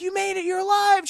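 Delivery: high, loud delighted line; mock excited relief. Deckard texting Sarah, high excited relief. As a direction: burst out glad she survived. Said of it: "he's like".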